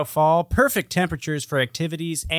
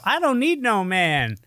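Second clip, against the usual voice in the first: higher mock declaration; mocking quote; 9 semitones higher than usual. Host mocks a slogan-shouting woman, higher mock declaration. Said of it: "The ones that yell".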